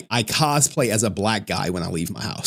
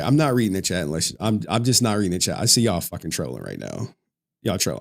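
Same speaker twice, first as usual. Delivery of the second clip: usual speaking voice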